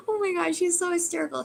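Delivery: high, laughing through the words. Real, not acted. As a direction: still giggling as she talks. Laughing at the guide's humour.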